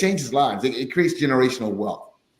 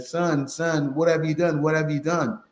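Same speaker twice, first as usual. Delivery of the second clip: a raised, worried mother asking her son; lightly voiced mom, pitch up and pleading; mother's anxious voice; 5 semitones higher than usual. Mother phoning her son about the car loan, higher and worried. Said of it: "his mother called him and said".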